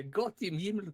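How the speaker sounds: German expression. Shown as a demonstration. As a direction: play up the German dismay. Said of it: "Gott im Himmel is a great expression".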